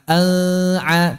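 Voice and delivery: "an'a" with the n blurred into a hum; nun disamarkan. Shown as a demonstration. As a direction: mask the n of "an'a", tongue not closing. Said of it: "misalnya disamarkan". ("an'a" dengan bunyi nun disamarkan)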